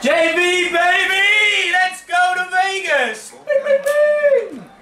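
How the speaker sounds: high, laughing celebration cheer; excited shout. Real, not acted. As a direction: cheer the winner at the top of your voice. Celebrating a Vegas win.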